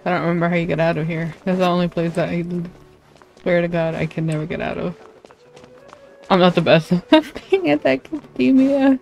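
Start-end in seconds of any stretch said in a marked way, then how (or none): none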